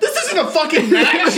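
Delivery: very high, loud incredulous outburst; outraged yell. Retold or imitated. Reacting to the absurd movie match, high outraged yell.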